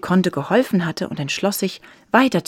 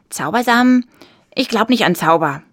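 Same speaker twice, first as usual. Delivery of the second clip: put-on snippy boy's retort, louder; 4 semitones higher, 5 dB louder than usual. A narrator's reading. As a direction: scoff in disbelief, pertly. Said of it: "gab er ihr schnippisch zurück".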